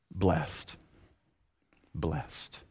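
very soft, low single word; quiet, low and weighted; hushed emphasis. Real, not acted. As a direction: let the word drop almost to a murmur. Hushed answer repeated for effect.

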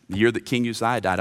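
normal talking voice

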